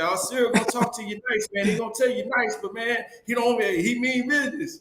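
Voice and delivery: high-pitched animated quote; light, lively impression of a coach talking; imitating Coach. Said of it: "Coach said".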